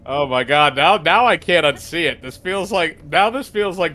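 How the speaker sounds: excited outburst. Real, not acted. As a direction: blurt it out as if you just saw something wild. Shocked realization watching game.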